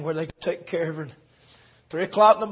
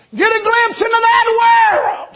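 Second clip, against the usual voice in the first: high, loud preacher's shout; preaching at full force; 10 dB louder, slower than usual. Acted. Preacher crying out to the congregation, loud and high. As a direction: ring it out over the church.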